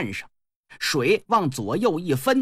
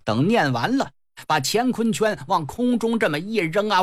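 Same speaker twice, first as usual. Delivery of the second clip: ordinary delivery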